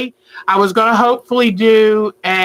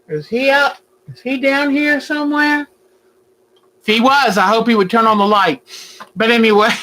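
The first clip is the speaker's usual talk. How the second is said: usual speaking voice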